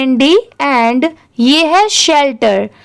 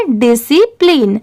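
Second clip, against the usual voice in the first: syllable-by-syllable model. Shown as a demonstration. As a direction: stretch out discipline part by part. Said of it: "अब इन तीनों को मिला देंगे".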